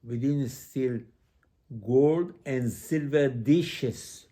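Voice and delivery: "gold and silver dishes" drawn out slow and loud; emphasized. Retold or imitated. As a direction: hit GOLD hard, then stretch "silver dishes". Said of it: "They emphasize".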